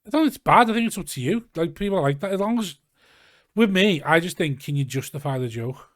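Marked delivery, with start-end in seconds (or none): 0.1-4.6 emphatic. Real, not acted. Emphatic reply to question.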